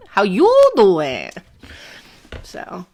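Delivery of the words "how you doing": slow, louder Brooklyn how you doing; a hint of a Brooklyn accent, drawled and louder. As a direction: lean into the Brooklyn greeting, stretched. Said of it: "in Brooklyn, it's like".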